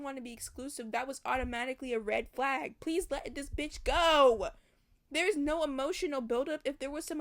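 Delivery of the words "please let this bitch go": loud, slow, exasperated begging; slow, loud fed-up plea. Real, not acted. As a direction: drag it out loudly. Pleading rant.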